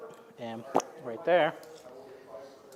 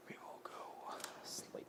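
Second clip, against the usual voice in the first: breathy whisper to himself; 17 dB quieter than usual. Real, not acted. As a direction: whisper the move under the breath. Muttering over a careful move.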